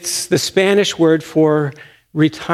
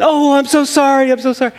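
a lightly voiced author, higher and embarrassed; flustered apology; 10 semitones higher, faster than usual. Author apologizing to her pastor for the wrong name, high and flustered. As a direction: gush out a hurried sorry. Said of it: "[name] realizes wrong name".

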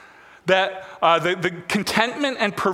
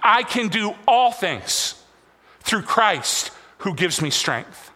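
slow, word by word, leading the room; slow, deliberate lead line for the congregation to repeat; slow call-and-response line; slower than usual. Shown as a demonstration. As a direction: spell it out slowly so the parents can follow. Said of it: "Every parent on me".

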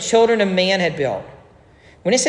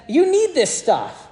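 much higher, slow pointed preacher line; imitating Alistair Begg; 10 semitones higher, slower than usual. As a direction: drive it home slowly like a pulpit punchline. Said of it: "And then he said".

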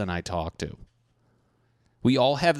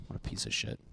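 muttered insult under breath; whispered; 11 dB quieter, faster than usual. Real, not acted. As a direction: whisper it with disgust.

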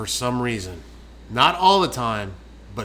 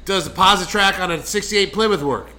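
loud, high mock-exasperated movie quote; Joe Dirt; 6 semitones higher, 5 dB louder than usual. Quoting a film at co-host, loud mock exasperation. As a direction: fire off the question, hammed-up. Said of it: "that's from Joe Dirt".

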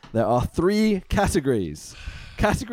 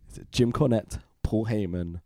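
usual speaking voice

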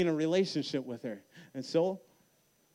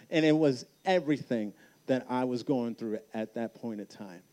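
ordinary delivery

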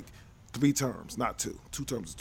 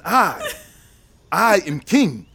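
put-on playful African king accent; hammed-up African accent, regal bit; Shaka Zulu accent; 4 dB louder, much slower than usual. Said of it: "That's the Shaka Zulu accent".

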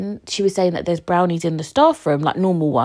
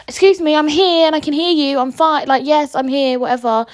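indignant retort; 8 semitones higher, 4 dB louder than usual. Retold or imitated. Retorting to staff ignoring her.

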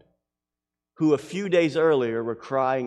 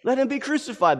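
crowd's cry; 13 semitones higher, 4 dB louder than usual. Acted. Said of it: "the crowd says".

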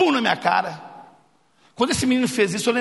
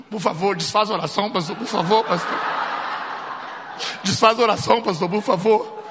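put-on begging boys. Boys beg pastor to undo prayer.

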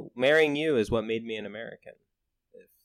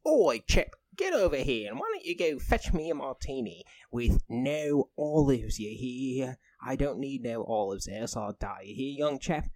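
put-on British accent; 2 semitones higher, 3 dB louder than usual. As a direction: bark orders in a hammed-up British voice. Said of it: "the mayor goes".

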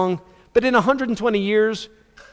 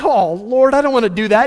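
loud, high mock whine of protest; reluctant complaint; 5 semitones higher, 5 dB louder than usual. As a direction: plead to be let off, hammed up. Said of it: "And you're like".

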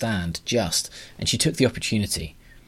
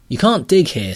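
higher and louder, laying down the law; firm heroine order; 8 semitones higher, 7 dB louder than usual. Acted. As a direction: forbid it outright, raised voice. Astrid ordering the grubber.

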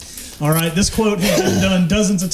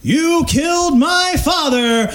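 impression; 8 semitones higher, slower than usual. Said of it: "with the impression that I got".

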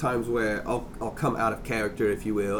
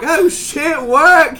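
high, loud amazed outburst; excited; 11 dB louder, much slower than usual. Retold or imitated. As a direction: blurt it out, impressed. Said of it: "I'm like".

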